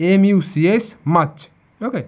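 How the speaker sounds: ordinary delivery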